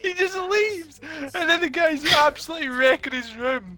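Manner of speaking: high laugh-choked telling; fighting laughter to finish the thought; real laughter fading out; still laughing. Real, not acted.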